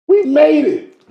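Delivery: high, loud burst of joy; happy cheer. Retold or imitated. Retelling his joy at three hundred thousand a month, high excited cheer. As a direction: cheer the big win out loud. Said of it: "first, I'm happy because I'm like".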